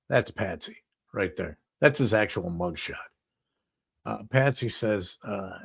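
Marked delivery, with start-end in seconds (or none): none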